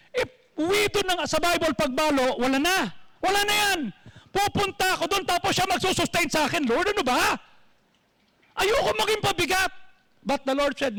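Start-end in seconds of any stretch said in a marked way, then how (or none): 0.1-9.7 higher, pleading complaint with a catch of tears; whiny Elijah protest. Acted. Elijah pleads with the Lord, high and whiny. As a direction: protest to the Lord like a reluctant prophet. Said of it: "Sabi ni Elijah".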